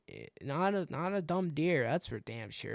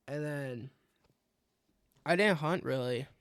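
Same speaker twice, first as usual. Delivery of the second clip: normal talking voice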